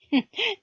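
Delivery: mocking burst of laughter; scornful laugh. A narrator's reading. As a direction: laugh him off, high. Sol mocks the powder trick.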